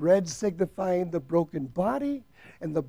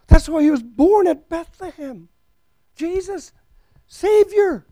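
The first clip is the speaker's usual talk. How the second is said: preaching at full force; 8 semitones higher, 9 dB louder than usual. Acted. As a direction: hammer each word home to the congregation.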